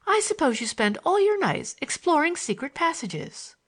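put-on high, girlish, full of wonder; eager young girl, pitch swooping up; eager schoolgirl. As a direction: gush about the mystery in a high schoolgirl lilt. Voices the schoolgirl in dialogue.

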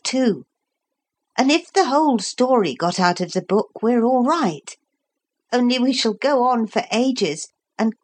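put-on a boy thinking it through aloud; Philip. A narrator's reading. Philip explaining the book to Lucy, lightly voiced boy.

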